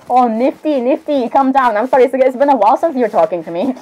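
usual speaking voice

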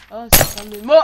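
loud startled cry; startled shout. Real, not acted. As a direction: jump and shout it out. Startled by jumpscare.

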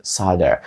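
solder said as "SAH-der"; American solder with an open "ah"; American pronunciation. Shown as a demonstration. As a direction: drop the l in solder, American style. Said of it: "А от американці кажуть".

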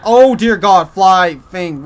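loud, high alarmed cry; panicked outburst at the enemy; startled yell. Real, not acted. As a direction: shriek it as the thing swoops in.

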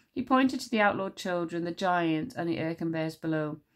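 ordinary delivery, nothing marked

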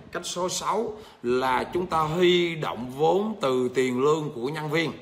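slow, spelled-out phrase for students to copy; slow dictation. Shown as a demonstration. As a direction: dictate the sixth method slowly. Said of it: "cách số mấy nhỉ?".

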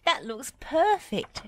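high, drawn-out praise; pleased high-pitched exclamation; delighted cry. A narrator's reading. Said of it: "she cried".

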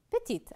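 "petite" with the final t clearly sounded, pitched high; feminine ending stressed. Shown as a demonstration. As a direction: make the final t of "petite" ring out. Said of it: "On va ajouter le E pour le féminin". (faire entendre le t final de « petite »)

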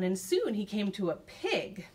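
ordinary delivery, nothing marked

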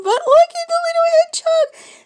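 high, loud squeal of delight; excited squeal. Real, not acted. Showing the little hedgehogs to viewers, squealing loud and high.

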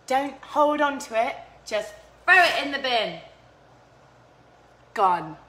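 usual speaking voice

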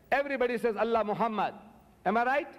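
normal talking voice

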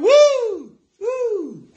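very high gospel "woo"; high whoop. Real, not acted. Preacher caps the remembered song, high sung whoop.

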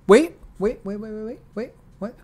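stunned, stopping mid-read; wait, what, in disbelief; surprised wait. Real, not acted. Stops reading in disbelief.

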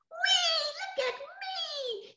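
put-on very high, drawn-out little boy's squeal; gleeful. Boy showing off on the swing, high gleeful call. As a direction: stretch out a high whee of delight.

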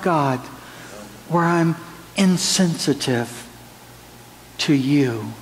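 earnest, pleading prayer to God; heartfelt prayer. Acted. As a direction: pray it aloud, humbly, before the congregation. Pastor prays aloud before congregation.

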